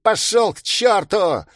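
high, loud defiant yell. A narrator's reading. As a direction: scream it in his face. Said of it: "завопил он".

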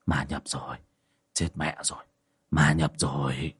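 soft, low muttering to himself; frightened mumbling. Acted. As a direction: mutter it under your breath in dread. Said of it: "mà lẩm bẩm".

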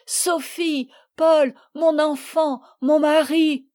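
high, drawn-out calling; wailed call to loved ones; mother's cries. A narrator's reading. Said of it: "J'entendais crier".

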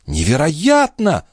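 high, drawn-out astonishment; incredulous exclamation; amazed declaration. A narrator's reading. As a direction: stretch the word in amazement. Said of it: "заявила Шон".

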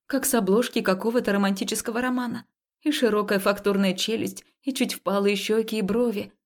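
ordinary delivery

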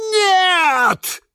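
high, loud crying shout; cry of dismay. Acted. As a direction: shout no in horror. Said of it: "закричали все сразу". (крик отчаяния, высоко и громко)

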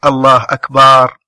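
mock stretched أكبر with drawn-out vowel; imitating the drawn-out أكبر of commoners; wrong Allahu akbar. Quoting common mistakes to the sheikh, stretched أكبر. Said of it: "ومنهم من يقول".

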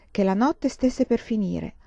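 ordinary delivery

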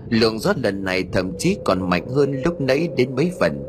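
ordinary delivery, nothing marked

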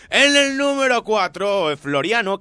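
high, loud, drawn-out announcement; countdown announcer. Acted. Host revealing number four to listeners, announcer style. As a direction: belt out the ranking like a show host.

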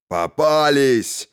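slow booming triumphant villain; thundering gloat. A narrator's reading. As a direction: drag it out, gloating over the catch. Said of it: "прогремел знакомый голос Атора".

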